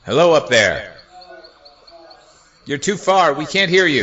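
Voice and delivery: loud shout up to someone far away; calling out. Acted. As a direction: holler upward, high and loud. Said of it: "lower your voice".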